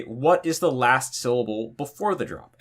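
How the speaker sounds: ordinary talk, nothing marked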